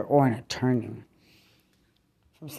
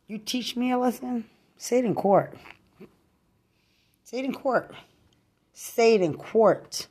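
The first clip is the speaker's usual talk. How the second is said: higher, jeering challenge repeated; angry taunt; 8 semitones higher than usual. Real, not acted. Ranting at her accuser, demanding court, jeering higher. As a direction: throw it back at them, mocking.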